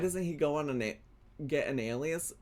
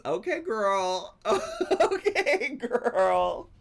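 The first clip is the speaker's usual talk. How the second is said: laughing, high teasing cheer; 8 semitones higher, 4 dB louder, slower than usual. Real, not acted. Co-host teasing the host about the compliment, laughing. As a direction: hype her up through a laugh.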